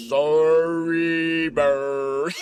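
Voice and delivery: very deep, slow, drawn-out apology; Snuffy voice. Pretend. Snuffy apologizing to Big Bird, very deep and slow. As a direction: sink way down and stretch it out.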